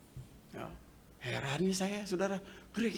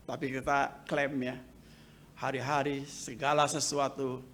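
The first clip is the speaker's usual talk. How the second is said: ordinary delivery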